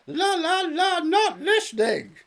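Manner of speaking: very high, loud sing-song refusal; mock fingers-in-ears chant; childish la la la. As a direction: drown her out like a sulky child. Father refuses to hear, childish.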